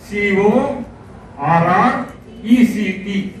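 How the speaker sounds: slow letter-by-letter spelling. Shown as a demonstration. Teacher spelling a word letter by letter to pupils, slowly. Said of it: "correct అంటే correct spelling".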